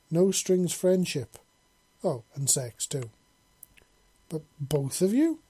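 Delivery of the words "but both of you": raised, louder questioning pitch, mildly incredulous; stammered disbelief. A narrator's reading. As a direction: ask it as if you can hardly believe it. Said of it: "he stammered in bel-, disbelief".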